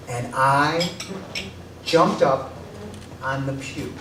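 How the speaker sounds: normal talking voice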